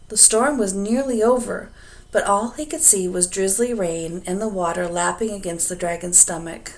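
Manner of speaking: plain reading voice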